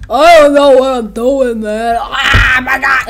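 loud hammed-up panicked cry; mock panic. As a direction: wail it, breaking into a yell. Voices hapless Sniper.

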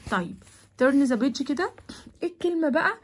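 normal talking voice

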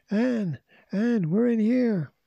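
high, drawn-out call for help. A narrator's reading. Trapped children calling to Anne outside, high urgent shout. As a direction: call through a wall to be found.

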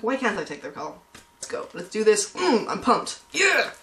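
ordinary delivery

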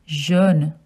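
jaune as zhohn; French pronunciation. Shown as a demonstration. Teaching children jaune. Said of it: "Yellow in French is".

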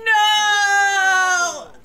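a loud wail of no, half laughing, half crying; anguished no. Real, not acted. To the game as the cow goes, a loud laughing wail. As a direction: cry out no as the cow is taken.

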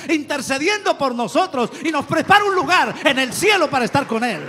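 loud, high, ringing pulpit cry; preaching at full force. Acted. Preacher calls out to the congregation, loud and high. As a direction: proclaim it to the whole church.

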